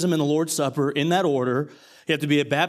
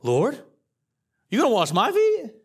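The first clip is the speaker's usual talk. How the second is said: Peter's disbelief, the pitch jumping higher on "feet"; can't believe the Lord would do this; incredulous Peter; 6 semitones higher than usual. Acted. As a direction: Peter shocked that Jesus will wash his feet. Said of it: "and Peter says".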